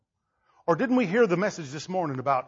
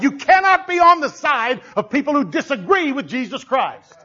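loud, raised sermon delivery; fired-up preaching; 7 semitones higher, 9 dB louder than usual. Acted. Preacher urging congregation to pick God's side, fired-up preaching. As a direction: preach it with mounting force.

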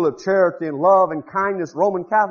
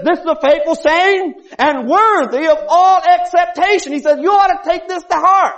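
full-force preaching; 9 semitones higher, 6 dB louder than usual. Acted. Preacher driving the point home to the congregation. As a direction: hammer each point home.

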